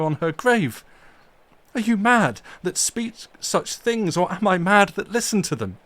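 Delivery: loud passionate fury defending her grave; storm of passion. Acted. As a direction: rage and plead against the desecration. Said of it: "cried Arthur in a storm of passion".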